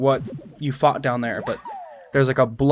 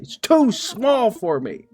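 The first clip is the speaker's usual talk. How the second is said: high, slow booming deity; hammy god voice; 8 semitones higher, slower than usual. Pretend. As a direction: ham it up like a god. Same god voice.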